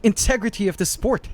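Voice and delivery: mock scolding.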